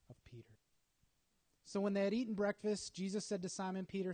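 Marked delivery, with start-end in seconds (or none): 0.1-0.6 whispered phrase ending. Real, not acted. Announcing Peter's restoration to the congregation, whispered. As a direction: let it fall to a whisper.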